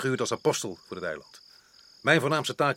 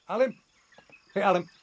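raised voice calling a servant's name; 5 semitones higher, 6 dB louder than usual. Acted. Resident calls his servant across the house, voice raised high. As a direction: call him from the next room, pitched up high.

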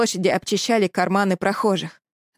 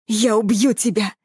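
hissed threat. Acted. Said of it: "прошипел он".

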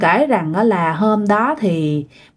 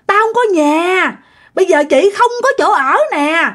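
ghostly woman's lament; 8 semitones higher than usual. Retold or imitated. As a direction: wail a homeless spirit's grievance, stretching the phrase ends. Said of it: "Mà kêu vậy đó".

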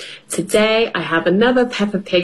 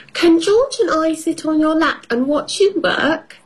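put-on high child voice asking; little girl voice; 8 semitones higher than usual. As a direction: plead like a small girl. Peppa voiced in story.

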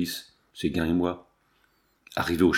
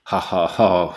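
low, spelled-out stage laugh; mock burst of laughter; 4 semitones lower, 4 dB louder, slower than usual. A narrator's reading. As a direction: read out a hearty, mocking ha-ha-ha. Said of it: "éclatant de rire".

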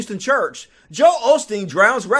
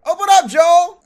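louder, higher demand; shout; 7 semitones higher, 7 dB louder than usual. Retold or imitated. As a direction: yell through the door at the pastor. Banging on the church door.

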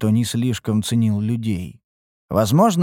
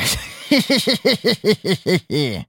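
slightly sinister chuckle, high; creepy laugh; 7 semitones higher than usual. Acted. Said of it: "Рассмеялся Мрадиш слегка жутким смехом". (слегка жуткий смех)

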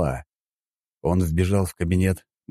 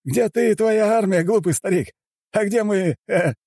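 high-pitched, agitated taunting; rattled Juan taunting in a high pitch; nervous mockery; 3 dB louder than usual. A narrator's reading. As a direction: jeer in a high, nervous character voice. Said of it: "после чего нервно расхохотался".

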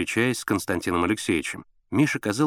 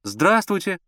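put-on high, slightly raised boy's greeting; raised boyish reply; loud answer; 10 semitones higher, slower than usual. A narrator's reading. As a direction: call a polite hello to the next room. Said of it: "громко ответил Миша".

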